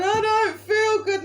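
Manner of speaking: high, loud, slow queasy moan; hammed-up ride sickness; mock sick whine. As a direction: groan it from someone's lap.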